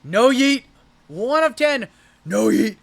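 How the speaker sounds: loud, high hype call; a big rip-reveal yell; excited shout. Real, not acted. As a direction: shout the card out like a pack-break hit. Excited card reveal call-outs.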